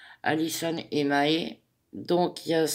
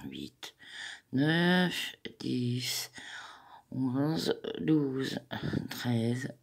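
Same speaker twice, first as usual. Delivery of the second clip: counting under breath; 4 semitones lower, 7 dB quieter, much slower than usual. Real, not acted. Counting stitches under her breath.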